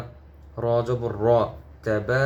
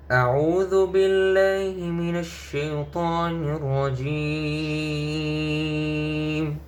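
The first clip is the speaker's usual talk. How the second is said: slow, higher melodic tilawah; Quran recitation; 4 semitones higher, much slower than usual. Acted. Teacher opening the lesson with slow melodic recitation. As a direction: chant it slowly like a qari.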